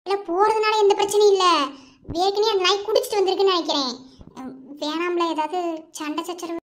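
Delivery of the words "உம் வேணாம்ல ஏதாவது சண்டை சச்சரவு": fearful murmur. Acted. Fearing a fight.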